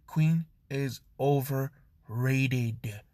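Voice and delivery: very slow, word-by-word emphasis; confrontational. Acted. Melissa right up in Izuku's face, slow and confrontational. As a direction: draw out each word of QUEEN IS OVERRATED. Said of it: "getting right up in his face".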